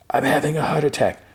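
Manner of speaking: high-pitched, insistent mock panic; retold panic. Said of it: "starts getting a panic attack".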